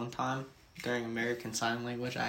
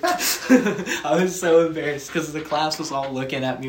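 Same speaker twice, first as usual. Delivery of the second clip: laughing through it; 4 semitones higher, 11 dB louder than usual. Real, not acted. Recalling the class staring, laughing. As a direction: laugh while admitting embarrassment.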